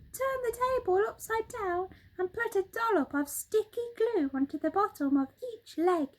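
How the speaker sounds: high, bright-pitched excited monkey voice; Mugglewump the monkey. Acted. Monkey father showing birds the living room, high excited voice. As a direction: chatter it out high and quick like an eager monkey.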